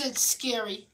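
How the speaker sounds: ordinary delivery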